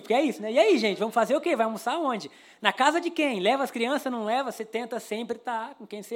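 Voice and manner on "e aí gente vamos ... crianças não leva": higher, louder, bubbly asking round the group; eager friend making plans. Retold or imitated. Churchgoer rallying friends for lunch, raised excited voice.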